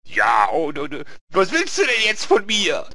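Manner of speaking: loud, high, exasperated demand; Lee. Acted. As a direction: shout the question, fed up. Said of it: "dann wieder der Lee".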